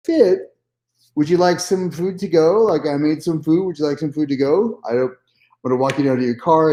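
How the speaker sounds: high, exaggerated sugary politeness with a laugh; over-sweet host. Retelling his overly gracious reply.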